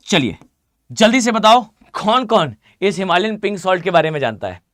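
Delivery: ordinary delivery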